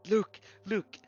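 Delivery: high excited shout. Real, not acted. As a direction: call it out, thrilled. Player spots a clue.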